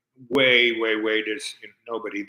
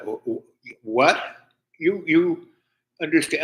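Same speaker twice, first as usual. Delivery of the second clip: retold dumbfounded stammer, rising louder and higher; stammering astonishment; 5 semitones higher, 4 dB louder, slower than usual. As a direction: stumble over the words in mock disbelief. Retells own baffled reaction to the woman.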